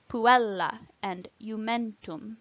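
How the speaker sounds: "puella" and "iumentum" slowly, stressed on EL and MEN; penultimate stress. Shown as a demonstration. Teacher showing short-vowel rule, "puella" and "iumentum" stressed second-last. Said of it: "second syllable from the end is stressed".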